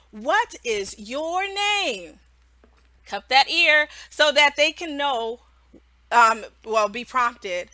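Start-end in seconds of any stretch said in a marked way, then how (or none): 0.1-2.2 "What is your name?" drawn out very slowly; slow teacher question. Shown as a demonstration. Teacher prompting a young student, very slow. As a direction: ask it slowly for a child to follow. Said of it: "or you can say".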